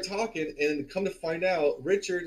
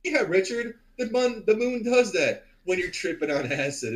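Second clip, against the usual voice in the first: higher, louder retold humouring; humouring reassurance; 7 semitones higher, 5 dB louder than usual. Teller to tripping Richard about the moon, humouring reassurance. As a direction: placate a tripping friend, pitched up.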